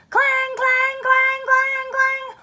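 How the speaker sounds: high, loud ringing clang; alarm bell. Acted. Narrator imitating the fire alarm, high loud clang.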